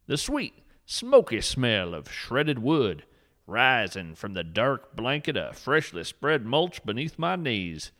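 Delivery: usual speaking voice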